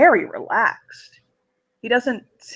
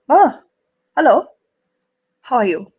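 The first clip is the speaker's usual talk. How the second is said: put-on German accent, with a bright lifted greeting; 5 semitones higher, 4 dB louder than usual. [name] greets Mega in a German accent. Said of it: "German accent comes out".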